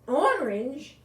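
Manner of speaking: put-on boy excitedly asking if the cat is orange; with excitement. A narrator's reading. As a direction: burst out with eager surprise. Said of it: "Bobby said with excitement".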